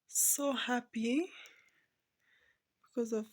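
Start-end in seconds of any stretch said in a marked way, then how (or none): 0.1-1.3 So happy stretched out slowly, rising at the end; slow, drawn-out happy. Real, not acted. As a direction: linger on So happy with a lift. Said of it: "I'm a proud mother".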